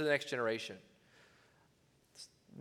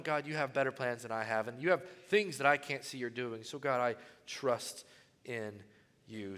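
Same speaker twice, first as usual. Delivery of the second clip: trusting prayer; 7 semitones lower, 8 dB quieter than usual. Retold or imitated. Contrasting trusting prayer to God.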